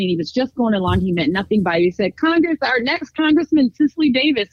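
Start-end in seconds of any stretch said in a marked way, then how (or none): 2.2-4.5 high-pitched imitation of an announcer; mock stage introduction, pitched up; event announcer. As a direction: introduce the candidate to a cheering crowd. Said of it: "someone announced me".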